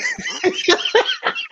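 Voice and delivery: high burst of real laughter. Real, not acted. Laughing while retelling the moment he turned off the car. Laughs at his own reaction.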